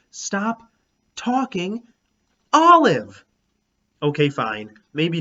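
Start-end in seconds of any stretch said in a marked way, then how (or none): 0.1-3.2 rising to a loud, much higher angry shout on Olive; angry growl. A narrator's reading. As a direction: snap it out, building to a yell. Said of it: "when I growled".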